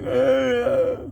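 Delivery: high, short put-on sob with head thrown back; mock crying sob. Coworker acts out crying like a little kid, mock sob. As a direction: imitate a grown man bursting into tears. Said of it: "showed us how he started crying".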